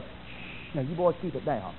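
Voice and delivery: a devil whispering orders to his demons; hushing devil. Acted. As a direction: shush them like a sly ringleader. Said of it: "Satan must be telling his demons".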